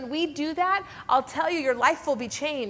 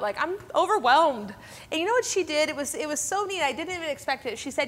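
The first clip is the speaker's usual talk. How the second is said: normal talking voice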